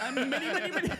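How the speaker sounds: playful imitation of an Indian accent. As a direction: tease him with a mock Indian accent. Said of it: "Why did you say that in an Indian accent?".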